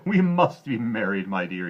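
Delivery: loud, eager king; a greedy king proposing to the girl. A narrator's reading. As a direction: press for marriage. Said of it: "he said".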